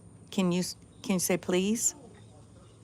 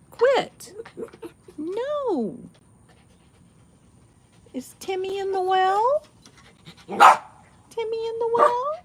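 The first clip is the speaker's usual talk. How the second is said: much higher and louder, mock alarm to the dog; high pet talk; 7 dB louder, slower than usual. Real, not acted. Owner teasing her barking dog in high loud baby talk.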